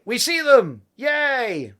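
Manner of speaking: high, loud mock cheer. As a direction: celebrate like the team spotting them. Team cheers bottles on radar.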